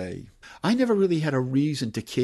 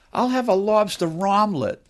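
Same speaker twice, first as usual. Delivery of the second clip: higher-pitched Medford diner order; Medford accent; 7 semitones higher, 4 dB louder than usual. Acted. Novelist reads a townsperson ordering food, Medford accent. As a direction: order it in a Boston-area accent. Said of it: "the sounds of that accent".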